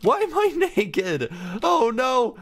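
high, laughing disbelief; startled gamer laughing at himself; surprised. Real, not acted. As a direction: squeal at being naked. Surprised at own avatar.

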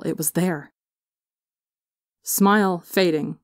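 usual speaking voice

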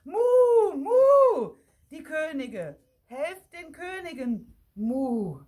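high, loud moos with cries for help; alarmed mooing, easing off at the end; cows calling out. A narrator's reading. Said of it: "riefen alle Kühe am Gatter".

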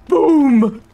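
put-on loud childish boom; hermit's crude pantomime boom; mock explosion. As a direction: burst it like a kid's explosion. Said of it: "makes crude explosion noises".

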